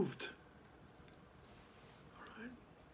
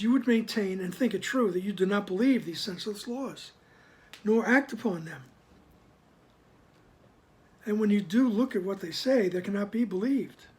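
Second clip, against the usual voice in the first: firm, louder reading of the text; formal reading; 5 dB louder than usual. Acted. Teacher reading scripture to the group, in a firm, louder reading voice. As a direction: read the passage out with weight.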